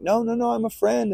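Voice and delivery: retold high-pitched plea; acting out a hurried, high protest; frantic reassurance. Flyer to panicking worshippers, high pleading retelling.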